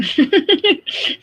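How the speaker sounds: sudden high-pitched laugh; high burst of laughter. Real, not acted. Laughing at her own joke.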